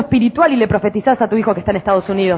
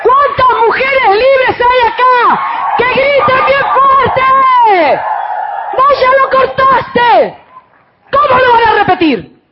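loud, high, fervent preacher shouting; full-force preaching; 5 dB louder, much slower than usual. Acted. Pastor preaching to the women, shouting with fervor. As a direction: preach it out to the whole church.

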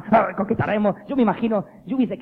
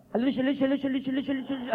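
repeating till tongue trips; 4 semitones higher, 4 dB quieter, faster than usual. Shown as a demonstration. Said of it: "Lección dos, decir".

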